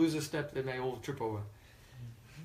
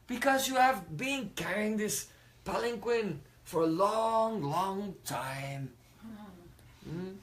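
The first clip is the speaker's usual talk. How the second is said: slow, higher, mock-sympathetic drawl; sarcastic; 4 semitones higher, slower than usual. King mocking Jad Bharat, slow sarcastic sympathy. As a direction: drip fake concern over him. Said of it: "he was very sarcastic".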